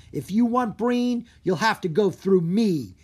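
loud, defiant call; brave challenge. A narrator's reading. Drake facing the ogre, loud defiant challenge. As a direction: stand your ground and call it out. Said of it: "he called out".

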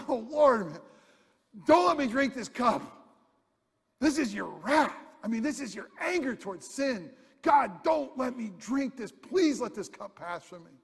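loud, high desperate plea; anguished cry. Retold or imitated. Jesus pleading with God not to drink the cup, loud anguished cry. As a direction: cry out to God in agony, begging. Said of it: "And he cries out".

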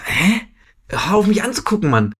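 a fed-up puzzled grumble in her head; irritated inner thought. Retold or imitated. Telling a friend how the staring woman annoyed her, mock exasperated.

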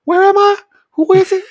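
high, loud French-ish confused voice; Ludovic impression. Coworker Ludovic playing dumb when caught, high confused squeak. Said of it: "when he was playing shit off".

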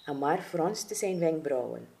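ordinary delivery

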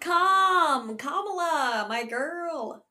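high, loud squeal of her name; excited. Real, not acted. Co-host thrilled to talk about Kamala, excited squeal. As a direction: cheer her name like a fan.

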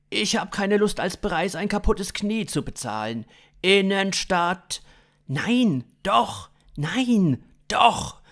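loud, high bickering; acted squabbling. Acted quarrel, no versus yes.

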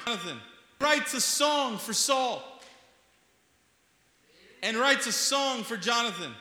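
high, loud, rising sermon climax; full-force preaching. Acted. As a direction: drive it home to the congregation at full volume.

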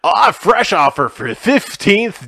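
loud, drawn-out strip-club announcer; stripper DJ voice. Pretend. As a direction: boom it out like a club DJ, stretching words. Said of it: "talking in my stripper D J voice".

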